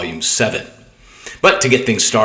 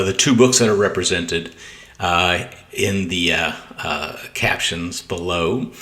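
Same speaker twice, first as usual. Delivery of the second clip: ordinary talk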